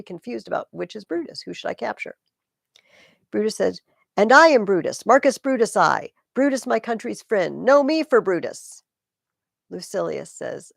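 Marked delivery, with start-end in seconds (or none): 4.2-8.8 loud, proud cry of a false name; defiant shout. Acted. Lucilius to the soldiers, loudly claiming to be Brutus. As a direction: shout it so the enemy hears.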